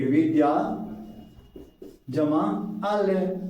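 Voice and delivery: जमा आल्य drawn out slowly, syllable by syllable; slow split. Shown as a demonstration. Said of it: "बिल्कुल आसान सा तरीका". ("जमा आल्य" को धीरे धीरे खींचकर बोलो)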